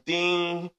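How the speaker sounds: playful school bell imitation; bell ding. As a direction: mimic the class bell chiming.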